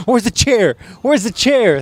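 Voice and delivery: high, eager wrestler asking for a chair; mock wrestler. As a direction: hype yourself up for a chair shot. Acting a wrestler in school.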